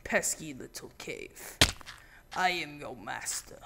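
low, hushed, hammed-up villain monologue; lightly voiced brooding overlord taunting a cave; mock-grand whispered drama; dramatic voice. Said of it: "in dramatic voice".

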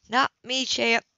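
plain reading voice

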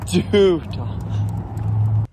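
long high call. Real, not acted. Owner calling his dog Duda in a long high call. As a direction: stretch out the dog's name calling her.

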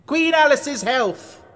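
high, loud, drawn-out cry; screamed toast. A narrator's reading. Red Queen toasts Alice before the guests, screaming. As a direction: call the toast out at top voice. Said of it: "screamed at the top of her voice".

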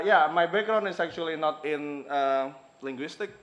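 ordinary talk, nothing marked

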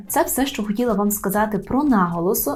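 normal talking voice

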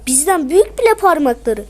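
ordinary talk, nothing marked

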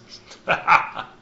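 high, scornful envoy's laugh; mocking laugh. Hawthorne laughing at the counselors, high and mocking. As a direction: laugh down at the council. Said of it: "and he laughs".

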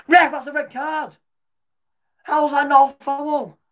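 high, loud outraged yelling; real furious protest. Real, not acted. Fan raging at the referee over a foul, furious high yelling. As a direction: rage at the ref's decision.

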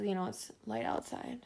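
usual speaking voice

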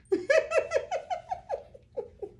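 a bright, high burst of laughter; real laugh. Real, not acted. Vlogger laughing to viewers about the cowboy hat, a high burst. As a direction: laugh out loud at your own excitement.

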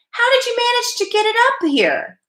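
very high-pitched amazed question; incredulous boy, pitch shooting up; disbelieving. A narrator's reading. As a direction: ask in squeaky astonishment. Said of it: "Keith could not believe it".

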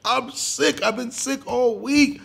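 high, slow, coughing pretend-sick child; cough, then whine it out; fake sick cough and whine. Boy acting sick again for Capone, coughing and whining. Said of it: "he's like".

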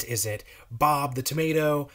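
plain reading voice

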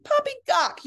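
high scoffing outburst; indignant scoff. Acted. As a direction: dismiss it with a huff. Indignant old lady.

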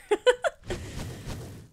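breathy high laugh of relief; relieved laugh. Real, not acted. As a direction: laugh out the leftover fear. Relief after the scary attack.

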